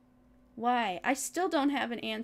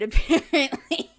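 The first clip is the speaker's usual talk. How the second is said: laughing sarcasm; 3 semitones higher, 8 dB louder than usual. Real, not acted.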